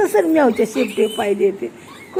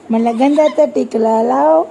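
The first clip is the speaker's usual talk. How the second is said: ordinary talk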